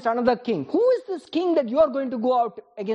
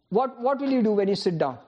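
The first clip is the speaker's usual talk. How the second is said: ordinary talk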